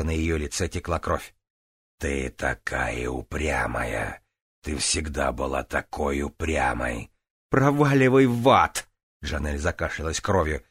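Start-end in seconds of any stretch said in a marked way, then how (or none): 2.0-7.1 low slow demonic voice; drawn-out, menacing demon queen; низкий голос демона. Acted. Демон-королева упрекает Джанель. 7.5-8.9 loud high defiant yell; defiant shout. Acted. Джанель кричит на демона.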